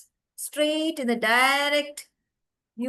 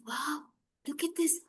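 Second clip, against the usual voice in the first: soft, slightly raised amazed voice; hushed wonder; 3 semitones higher, 7 dB quieter than usual. Acted. Boy sees the firefly start glowing, hushed wonder. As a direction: marvel softly at a tiny marvel.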